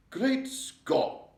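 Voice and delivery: high, loud exclamation of shock; shocked cry. A narrator's reading. The Queen seeing the headlines, a shocked cry. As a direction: cry out in royal astonishment. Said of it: "cried the famous voice".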